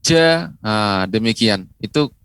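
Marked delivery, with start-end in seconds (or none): 0.0-0.5 JA with the j pressed hard; wrongly pressed jim. Shown as a demonstration. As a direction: force out the j of JA as a mistake. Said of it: "tidak boleh pengucapannya ditekan". (ja yang keliru, ditekan)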